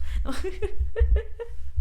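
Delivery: high breathless giggle; laughing. Real, not acted. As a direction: burst into giggles at yourself. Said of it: "No sé por qué me estoy riendo tanto".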